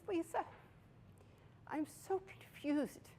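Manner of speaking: confused mother. Retold or imitated. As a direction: gently voice the mom's confusion, lifted and soft. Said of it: "and she goes".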